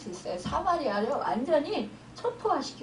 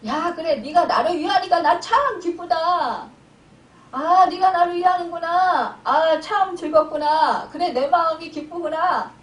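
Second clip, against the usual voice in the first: loud, higher-pitched imagined divine approval; pleased God; 3 semitones higher, 6 dB louder than usual. Acted. God praising the one who claims zeal, acted delighted. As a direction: play God heartily delighted with a servant. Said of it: "그렇게 말하면 하나님이".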